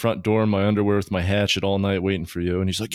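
usual speaking voice

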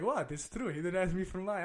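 high-pitched mock innocence; defensive dad. Dad defending himself to his upset kid, lightly defensive.

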